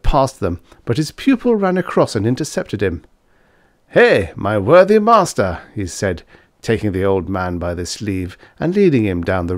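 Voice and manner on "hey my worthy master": put-on merry young Fritz, louder and higher, calling out; tipsy student voice. Fritz hailing his professor on the road, loud and merry. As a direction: hail the old man boisterously, half drunk.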